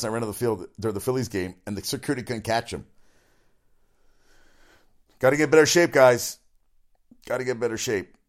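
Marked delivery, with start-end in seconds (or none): 5.2-6.4 mock yell. Teasing the security guards who couldn't catch a fan, loud mock yell. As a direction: yell it out like heckling from the stands.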